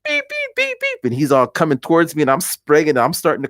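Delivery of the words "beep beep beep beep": high, piercing imitated alarm beeps; imitated high-pitched electronic beeping; smoke detector beeps. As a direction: mimic a smoke alarm's even, repeated beeping. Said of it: "the little smoke detector starts".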